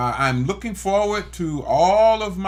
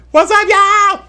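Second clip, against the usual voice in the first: calling out; 11 dB louder than usual. Real, not acted. Hollering out to family.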